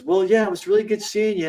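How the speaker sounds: high mock farewell of old classmate; mock schoolmate, sugary and high; fake-friendly goodbye. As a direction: gush a goodbye. Bully saying goodbye.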